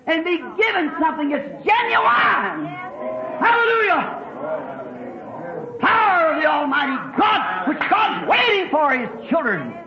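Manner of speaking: exultant shouted hallelujah peak; ringing pulpit cry of praise; fervent preaching. Real, not acted.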